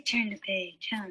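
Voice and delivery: normal talking voice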